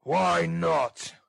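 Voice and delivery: angry ruffian's outburst; cried out. Acted. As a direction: shout it back as a surly bully. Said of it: "cried Mister Sikes".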